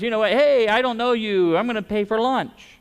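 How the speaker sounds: mock voice of a random generous stranger; breezy kind stranger. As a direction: toss it off like a spontaneous giver.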